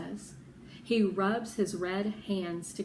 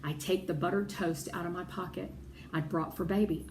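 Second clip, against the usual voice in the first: ordinary delivery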